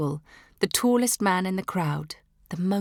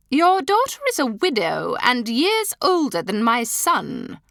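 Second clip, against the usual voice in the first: higher, louder, cutting attack; combative; 7 semitones higher, 6 dB louder than usual. A narrator's reading. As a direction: strike back at the mother. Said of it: "joining battle".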